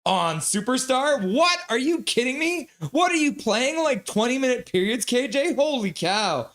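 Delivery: high-pitched, astonished questioning; incredulous outburst. Real, not acted. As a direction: react in lively disbelief. Reacts in disbelief to viewer's score.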